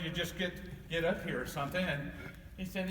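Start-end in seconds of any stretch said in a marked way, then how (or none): none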